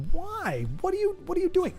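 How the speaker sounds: very high, incredulous cry; mock exasperation. Streamer questioning chat's invented card, high-pitched and exasperated. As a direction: wail the question in mock disbelief.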